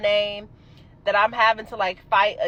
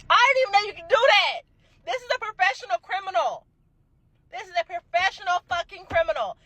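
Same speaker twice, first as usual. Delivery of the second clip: higher, exclaiming in disbelief; shocked, higher-pitched outrage; outraged disbelief; 8 semitones higher than usual. Real, not acted. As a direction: marvel at how bold she was.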